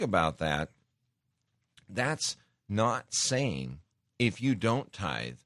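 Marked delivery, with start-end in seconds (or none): none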